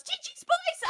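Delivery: very high squeaky shout-out; high mock gamer call; mocking Yara. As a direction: squeal it like a cheeky gamer girl. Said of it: "she goes".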